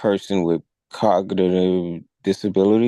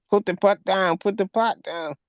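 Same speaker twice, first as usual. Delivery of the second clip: mock yell; 12 semitones higher than usual. Both officers yelling at the woman, high mock yell. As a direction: mimic cops yelling, pitch way up.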